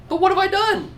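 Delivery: high-pitched protest in reading; pleading protest. A narrator's reading. David protesting to Achish as the pastor reads, high protest. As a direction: read it as a high, pained appeal.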